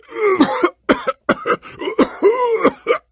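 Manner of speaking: harsh, high coughing fit; hacking cough. Acted. Sickly hero breaking into a hacking cough. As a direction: cough hard and rough.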